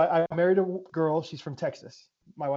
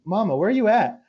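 put-on child's voice; 6 semitones higher, 5 dB louder than usual. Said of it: "has started saying".

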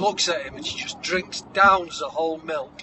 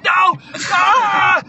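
loud, high scream of effort; hammed-up agonised shout; strained yell; 11 semitones higher, 9 dB louder than usual. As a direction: cry out while shoving with all your might. Moana straining at the rock.

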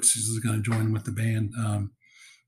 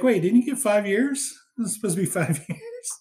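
high, startled disbelief; shocked maitre d'; 8 semitones higher than usual. Retold or imitated. Maitre d' seeing the ex-convict again, high startled disbelief. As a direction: act caught off guard, voice jumping up. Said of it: "He's shocked".

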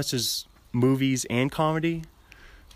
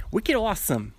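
higher-pitched mock Boston accent; 8 semitones higher than usual. Comic gushing over the town, terrible Boston accent. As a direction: ham up a bad Boston accent. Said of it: "do a Boston accent".

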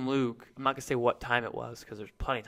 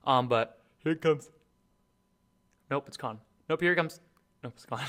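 holding back a sneeze; about to sneeze. Real, not acted. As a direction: talk through a coming sneeze. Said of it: "I'm gonna sneeze here in a minute".

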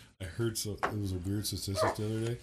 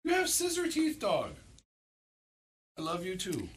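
pet talk; 11 semitones higher than usual. Real, not acted. Talking to the dog.

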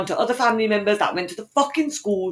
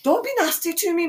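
high whine; whiny plea; 12 semitones higher than usual. Real, not acted.

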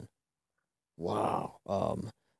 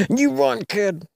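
heroic shout; 12 semitones higher, 5 dB louder, slower than usual. Acted.

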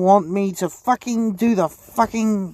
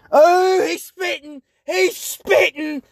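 much higher, slow hype; hyped voice act; 11 semitones higher, 3 dB louder, slower than usual. Acted. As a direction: shout it like a cheering fan. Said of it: "And then there's".

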